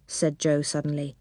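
ordinary delivery, nothing marked

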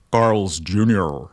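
slow, drawn-out American accent. Shown as a demonstration. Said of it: "I have to say this in an American accent".

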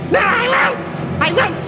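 high screeching witch defiance; witch refusing. A narrator's reading. Witch refusing God's order, screeching. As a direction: spit it back.

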